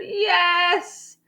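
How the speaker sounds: high-pitched, insistent retort; stubborn insistence. A narrator's reading. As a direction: argue back, digging in. Cashier insists in quarrel.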